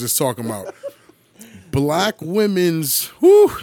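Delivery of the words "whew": high, loud exhale of disbelief; loud whew. Real, not acted. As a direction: blow out a big whew. Reaction to topic.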